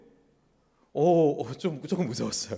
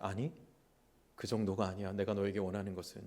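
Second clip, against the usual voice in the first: tender voice of God; 7 semitones lower, 11 dB quieter than usual. Acted. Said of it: "하나님은 이렇게 말하는 거죠".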